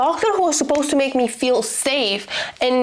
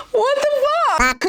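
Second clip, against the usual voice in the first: much higher, slow outraged gasp; shocked disbelief; 8 semitones higher, slower than usual. Real, not acted. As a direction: stretch it out, horrified. Reacting to the book blurb.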